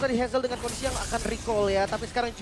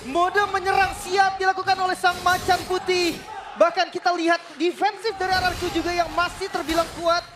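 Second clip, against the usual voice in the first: hyped announcer; 10 semitones higher, 8 dB louder than usual. Real, not acted. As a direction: build the tension like a ring announcer. Co-caster hypes the Lord push. (gaya penyiar pertandingan yang lantang)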